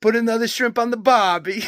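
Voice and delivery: put-on Lloyd's goofy Australian accent, cracking up; mock Australian accent. Retelling Dumb and Dumber joke, mock Australian accent with laughter. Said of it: "He goes".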